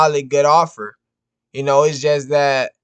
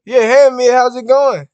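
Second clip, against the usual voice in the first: higher, louder cheerful hello; upbeat customer-service voice answering the phone; bright phone greeting; 7 semitones higher, 7 dB louder than usual. Real, not acted. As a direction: greet the caller with energy.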